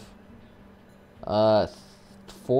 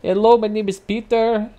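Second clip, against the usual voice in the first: high, loud hammed-up imitation of an Indian scam caller faking an American accent; mock the caller's fake American accent, squeaky and overdone; 11 semitones higher, 5 dB louder than usual. Streamer mocking a spam caller from Cali, mock Indian-American accent. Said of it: "trying to pull off his best American accent".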